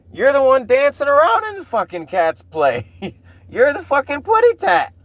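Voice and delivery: mock him while cracking up; laughing mockery. Real, not acted. Mocking Bill dancing in Cats.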